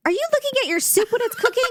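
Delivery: high, quick mock interrogation; lightly voiced suspicious mother; questioning mom. Mom quizzes her kid about the soup, suspicious.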